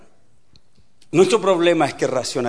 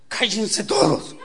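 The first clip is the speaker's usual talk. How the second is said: slow, higher-pitched tense command; tense novice driver; 5 semitones higher, slower than usual. Acted. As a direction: order everyone silent, nervously overacted. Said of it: "Y va tensa allí".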